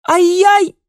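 very high, loud yelp; shrieked cry. A narrator's reading. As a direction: yelp out loud at top pitch. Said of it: "крик повторился снова".

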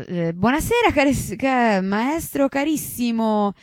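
high, stretched greeting put on for a passer-by; sudden fake cheer. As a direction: switch to a gushing welcome mid-sentence. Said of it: "esclamò Torri". (saluto mellifluo e sorpreso)

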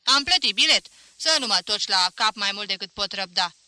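loud, rough complaint from the crowd; irritated shout from the seats; angry heckler. A narrator's reading. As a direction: shout him down, fed up. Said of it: "îi ceru profesorului să-și scurteze pălăvrăgeala".

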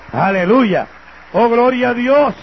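loud, rising praise shout; preaching at full force. Real, not acted. Preacher praising God before the congregation, loud and fervent. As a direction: lift the hall with a fervent hallelujah.

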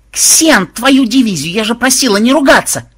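loud, high exasperated scolding; fierce bark. A narrator's reading. Reporter scolds the swearing programmer, fierce high shout. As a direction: shout it furiously at him. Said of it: "свирепо рявкнула Мелиса".

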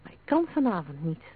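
plain reading voice

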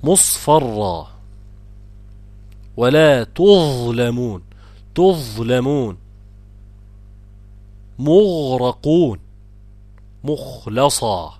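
slow, stretched model recitation from مصفرة to مخلصة; deliberate recited examples like مغرقون, long vowels drawn out; tajweed demonstration. Shown as a demonstration. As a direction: dwell on each heavy consonant after u, as in تظلمون. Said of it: "كل دي مفخمات".

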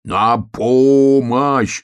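long drawn-out loud call; high, loud bear cub yell; shouted cry for help. A narrator's reading. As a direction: holler slowly, stretching every vowel. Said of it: "закричал медвежонок".

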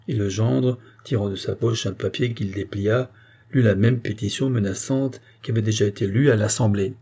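ordinary delivery

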